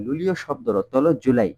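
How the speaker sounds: normal talking voice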